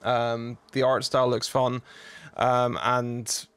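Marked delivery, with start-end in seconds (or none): none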